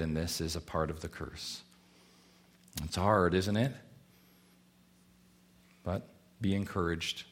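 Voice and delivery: soft and low, consoling; gentle comfort. Acted. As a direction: ease the hurting mothers. Soft pastoral encouragement.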